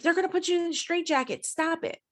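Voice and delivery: high, fast retold panicked warning; freaking out. Husband warning his wife about a straitjacket, freaking out. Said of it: "he was freaking out".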